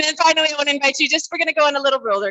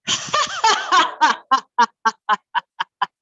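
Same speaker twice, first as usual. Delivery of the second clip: long string of deliberate ha-has, higher; laughing breath; 4 semitones higher than usual. Shown as a demonstration. Leader demonstrates for the group, laughing breath. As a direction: laugh out one whole breath. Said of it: "kind of a laughing breath".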